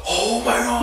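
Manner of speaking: high, laughing mock gasp of wonder; awed squeal, played for laughs; imitated amazement. Imitating a first-time viewer's reaction.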